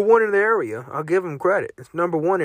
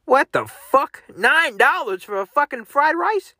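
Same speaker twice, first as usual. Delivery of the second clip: outraged disbelief; 8 semitones higher than usual. Real, not acted. Outraged at menu prices.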